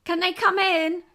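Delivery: high, loud call to another room. Real, not acted. Waiting for the family visitors, calling out. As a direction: raise your voice so they hear you next door.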